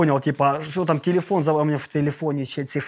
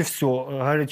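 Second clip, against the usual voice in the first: ordinary talk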